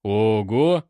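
loud impressed exclamation; acted "well, well" of amazement. A narrator's reading. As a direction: react with appreciative surprise. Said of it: "присвистнул поручик".

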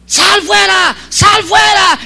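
high, loud commanding yell; retold shout. Leader yelling at the girl to drive out the demon, high and loud. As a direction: bark the command to cast out the demon. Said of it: "en su oído gritándole".

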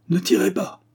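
urgent shout, pitched well up; crying out. A narrator's reading. Inspector stopping the chief from firing, crying out. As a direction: call out a sudden high command. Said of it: "lui cria Paul Bard".

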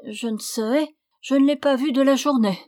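voix plus haute, plaintive et jouée; fausse malade suppliante. Acted. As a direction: supplier en feignant la migraine. (la suivante cachée supplie le seigneur de ne pas ouvrir)

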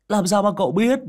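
high, loud comic-sidekick voice; startled question. A narrator's reading. Tiểu Minh questions his companion, high and astonished. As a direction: blurt it out in high-pitched surprise.

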